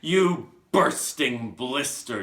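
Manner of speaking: slow, drawn-out roared insult; furious yell. Acted. Trunchbull insulting the counting Wilfred, furious yell. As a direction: bellow it, stretching every word. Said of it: "yelled the Trunchbull".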